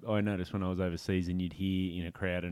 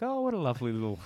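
sweet mock innocence; 5 semitones higher, faster than usual. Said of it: "these kids says".

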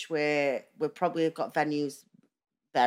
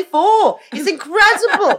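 high squeal of delight; excited laughing; 13 semitones higher, 10 dB louder, slower than usual. Real, not acted. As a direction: gush with a laugh. Gushing about the day out.

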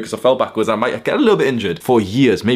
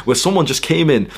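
usual speaking voice

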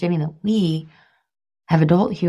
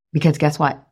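usual speaking voice

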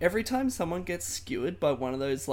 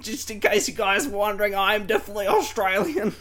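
loud, higher-pitched caricature of an Aussie; exaggerated Australian accent; 5 semitones higher, 7 dB louder than usual. Host mocks the Australian dude among Americans, hammed-up Australian accent. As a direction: announce yourself in an over-the-top Australian accent. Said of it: "hearing an Australian accent".

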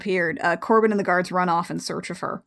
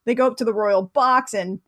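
ordinary talk

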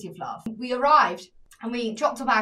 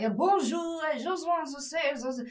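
imitating French waiter; 4 semitones higher, 4 dB quieter, slower than usual. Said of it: "he's like".

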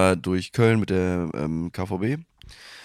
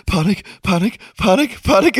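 rising panicked chant, getting higher and louder; mock panic; 9 semitones higher, 4 dB louder than usual. Storyteller reliving panic, rising higher and louder. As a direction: build the panic word by word.

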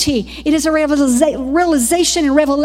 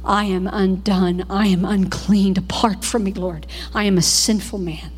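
lament of a prophet; 6 semitones lower than usual. Retold or imitated. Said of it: "you hear the prophets say".

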